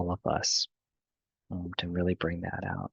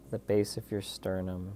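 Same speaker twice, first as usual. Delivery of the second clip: guided meditation; 9 dB quieter than usual. Real, not acted. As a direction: lead them gently through the breathing, unhurried.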